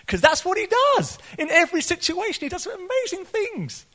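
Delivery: high-pitched, exultant proclamation; exultant preaching. Real, not acted. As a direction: proclaim it high and full of conviction. Preacher exulting in God's deeds.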